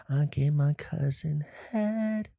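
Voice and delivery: drawn-out, oddly voiced confession; slow odd voice. Vlogger hams up his big reveal, slow and odd. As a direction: stretch each word in a strange put-on voice. Repeated joke line.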